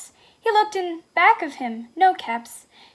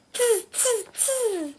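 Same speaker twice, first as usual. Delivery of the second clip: high hissy "tz" monkey noises; mocking high monkey tsk; monkey chatter; 8 semitones higher, much slower than usual. A narrator's reading. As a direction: mimic teasing monkeys in a high tz-tz. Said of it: "monkeys only shook their hands back".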